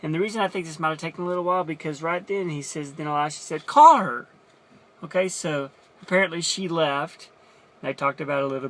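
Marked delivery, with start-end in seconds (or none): 3.7-4.3 loud, high call to summon her; sudden loud summons. Retold or imitated. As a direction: shout it across the house. Elisha calls out command.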